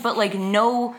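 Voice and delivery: normal talking voice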